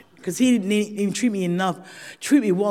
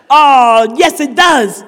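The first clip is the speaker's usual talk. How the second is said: loud, high, exultant confirmation; emphatic delight; 4 semitones higher, 10 dB louder, much slower than usual. Real, not acted. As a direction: belt it out with glee. Triumphant reply to the group.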